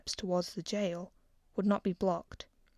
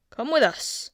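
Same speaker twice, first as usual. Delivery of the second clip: curt guard's command, a bit louder; guard's order; 5 semitones higher, 8 dB louder than usual. A narrator's reading. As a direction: order the prince to come. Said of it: "one of them said".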